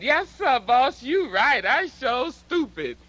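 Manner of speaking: high, slow, strained servile "Yes, sir"; 扮演被迫讨好白人老板的黑人男子，声音高而压抑. Retold or imitated. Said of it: "throat must have closed on him in pain".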